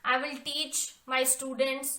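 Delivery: slow dictation. Shown as a demonstration. Teacher dictating English sentence.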